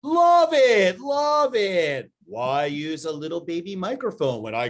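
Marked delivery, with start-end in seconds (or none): 0.0-2.1 high, loud, gushing verdict; excited. Real, not acted. Cohost answering love it or leave it, thrilled and loud. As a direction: belt out love it with glee.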